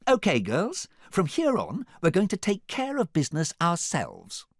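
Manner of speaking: high, rousing rally; rally the girls with confidence; renewed confidence. A narrator's reading. Fiona turns to the princesses, confident and rousing. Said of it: "with renewed confidence".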